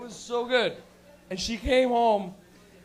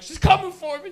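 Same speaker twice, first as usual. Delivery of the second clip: paranoid stoner; 8 semitones higher, 8 dB louder than usual. Retold or imitated. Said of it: "You're like".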